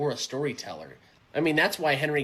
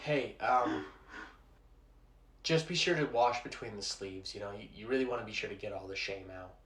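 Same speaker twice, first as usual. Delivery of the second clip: soft awkward request; 5 semitones lower, 9 dB quieter than usual. Acted. Gently asks friend to clean sink.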